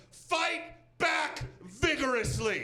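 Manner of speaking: slow, high and punched out; shouted sign command; all caps. Retold or imitated. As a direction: read it like it's in capital letters. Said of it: "in all caps".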